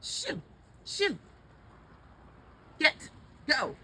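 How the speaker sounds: short, high, loud shouts; sharp bursts to scare off an animal; shooing an animal away. Real, not acted.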